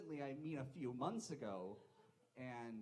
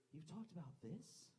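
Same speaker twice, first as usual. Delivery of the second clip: softer, rising disbelieving question; incredulous; 3 semitones higher, 6 dB quieter than usual. Acted. Onlooker on the train hearing the mom, incredulous. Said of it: "And I was like, "Wait".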